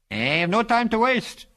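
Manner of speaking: slow, high-pitched unhurried remark; unbothered, drawn-out observation; dispassionate. A narrator's reading. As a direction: draw it out without concern. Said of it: "he observed dispassionately".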